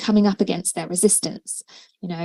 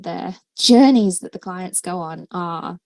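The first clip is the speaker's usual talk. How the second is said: usual speaking voice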